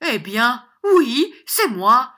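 loud, high-pitched brazen admission. A narrator's reading. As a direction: own up at full volume, daring him. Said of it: "répondit John en élevant la voix".

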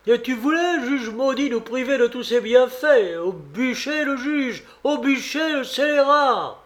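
high-pitched, indignant cry for vengeance; outraged crowd. A narrator's reading. Crowd condemning the judge to the stake, outraged. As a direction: hurl the accusation high, drawing out the verdict.

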